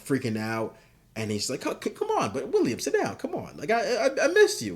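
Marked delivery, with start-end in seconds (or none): 1.7-4.7 sing-song, much higher voice with a laugh; overly sweet, creepy-friendly welcome; gushing Homelander. Retold or imitated. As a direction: Homelander greeting his old enemy, gushing high and sweet. Homelander greets Butcher in hell.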